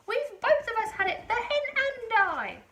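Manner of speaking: put-on high, loud old woman's shout; Grandma shouting. A narrator's reading. Grandma shouting down from the roof to Mary. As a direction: call it out proudly.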